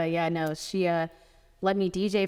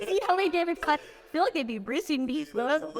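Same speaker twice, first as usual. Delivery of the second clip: laughing; 9 semitones higher than usual. Real, not acted.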